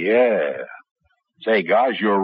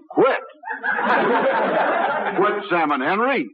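laughing in disbelief; 9 semitones higher than usual. Real, not acted. Shocked laugh at quitting.

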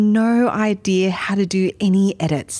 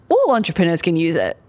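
normal talking voice

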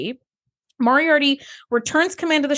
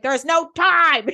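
impatient Riker; 4 semitones higher than usual. Retold or imitated. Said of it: "he doesn't even finish his sentence".

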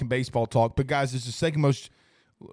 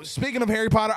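normal talking voice

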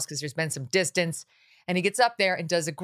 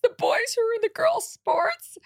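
mocking someone's fake tears in a much higher voice; mock fake crying. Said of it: "mock somebody's fake cry".

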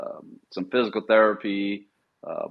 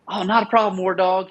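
imitating the monitor, much higher and louder; mock cheerful monitor; 11 semitones higher, 5 dB louder than usual. Monitor's cheerful reply retold.

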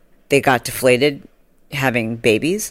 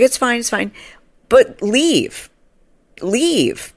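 awkward dismissal; 8 semitones higher, much slower than usual. Retold or imitated. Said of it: "I'm like".

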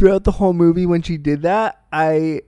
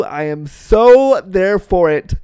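stretched, louder SO in "so there for it"; emphatic enthusiasm; 4 semitones higher, 6 dB louder, slower than usual. Real, not acted. Fan declaring devotion to a revival, emphatic. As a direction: lean hard and slow on SO. Gushing over a possible revival.

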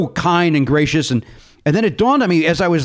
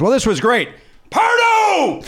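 high, hammed-up mock yell of Pardo; imitating a yell; 11 semitones higher, slower than usual. Mel Brooks yells the host's name on his way out, mock shout. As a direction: call the name out across the green room. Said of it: "At the top of his lungs".

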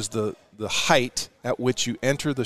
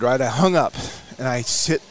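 normal talking voice

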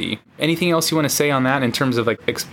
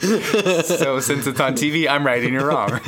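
laughing mock certainty; 3 semitones higher than usual. Jokes through a laugh.

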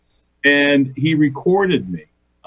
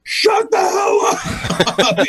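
shouting; much slower than usual. Retold or imitated. His shouted retort to the coach.

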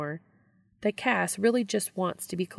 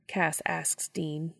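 plain reading voice

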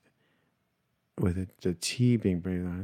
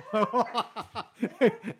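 high laugh; 13 semitones higher, 4 dB quieter than usual. Real, not acted. Laughing at barking dog.

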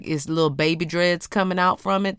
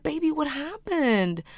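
gentle mom question; 10 semitones higher, 5 dB quieter than usual. Retold or imitated. Mother gently asks toddler.

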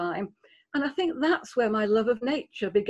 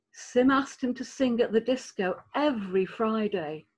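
plain reading voice

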